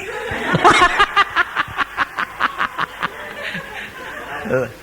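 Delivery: high breathy burst of laughter. Real, not acted. Preacher laughs at his own punchline.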